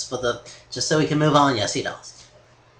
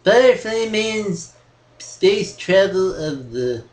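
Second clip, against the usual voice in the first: imitating the host's echo; 5 semitones higher, slower than usual. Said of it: "He just said week three times".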